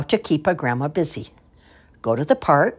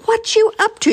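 high loud teasing; old sailor teasing the girl; playful high gruff; 5 dB louder than usual. Acted. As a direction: tease the little imp. Swashby rescues girl.

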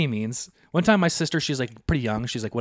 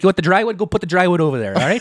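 loud, raised instructions; mock bossy dad; 5 semitones higher, 7 dB louder, faster than usual. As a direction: order the kid around impatiently. Dad orders son about wood.

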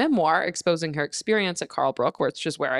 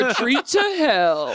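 higher-pitched, laughing tag line; laughing punchline; 5 semitones higher, 3 dB louder than usual. Real, not acted. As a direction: crack up while topping the joke. Topping the joke while laughing.

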